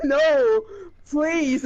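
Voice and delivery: very high, imitated desperate plea; hammed-up begging from a losing player; mock pleading. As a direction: cry out high, imitating despair. Retold opponent begging as he loses.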